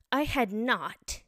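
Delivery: loud, slow indignant denial; sharp, very angry. A narrator's reading. Mouse contradicting Alice, sharply and angrily. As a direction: cry it out, stretching each word angrily. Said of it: "cried the mouse sharply and very angrily".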